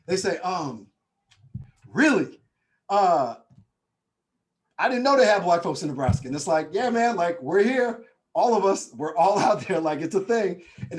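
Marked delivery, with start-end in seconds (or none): 1.9-6.3 loud, incredulous imitation; mock astonishment. Imitates astonished New Yorkers. 6.7-10.6 loud, higher, amused protest; emphatic insistence. Retold or imitated. Nebraskan telling New Yorkers they exist, emphatic insistence. Replays his emphatic defense.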